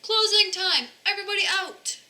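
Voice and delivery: high, loud closing call to the whole room. Acted. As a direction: shout it across the laundromat.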